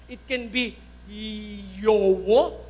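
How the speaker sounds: "Yowo" said with two round o vowels; pause, then offer "Yowo" as YO-wo; pronunciation variant Yowo. Shown as a demonstration. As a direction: show "Yowo" as a drawn-out alternative. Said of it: "Masama naman pagka sa Iloilo ko sinabi".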